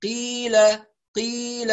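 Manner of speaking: "قيل" with a tight q, no puff of air; breathless qaaf. Shown as a demonstration. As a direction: hold the breath back on the "ق" of "قيل". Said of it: "هكذا بدون نفس". ("قيل" بقاف دون نفس)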